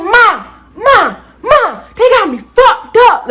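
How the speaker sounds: high hollering, slow on the last line; dramatic outburst. Retold or imitated. Student calling mom about trouble, high dramatic yelling. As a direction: call out for mom in loud outrage. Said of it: "I pulled a whole Camilla type shit".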